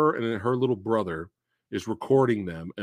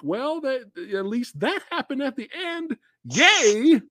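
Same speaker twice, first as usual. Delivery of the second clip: very high, put-on cheer; hammed-up high viewer voice; mock consolation; 12 semitones higher than usual. Mimics a viewer cheering the ending.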